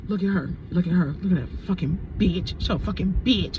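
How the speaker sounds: low-pitched contemptuous grumbling; mad spirit. Retold or imitated. Angry spirit cursing the woman who took luck, mad. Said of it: "Spirit was so mad".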